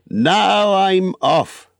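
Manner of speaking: high, long drawn-out declaration; excited announcement. Acted. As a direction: announce your departure with relish. Relieved Bilbo announces his departure.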